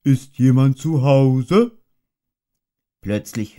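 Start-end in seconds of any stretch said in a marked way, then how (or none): 0.0-1.8 higher, louder, slow bear's call; a hint of a friendly shout; calling into the hole. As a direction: call politely down the rabbit hole.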